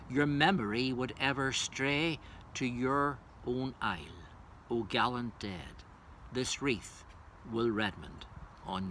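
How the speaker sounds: measured, solemn reading of verse; stately elegy delivery, rising on each address; poem recitation. Acted. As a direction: recite it as a tribute to the fallen.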